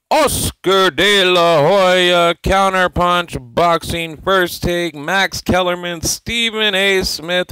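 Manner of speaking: slow booming ring announcer call; hype announcer. Acted. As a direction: call the names like a fight intro. Show intro roll call of names.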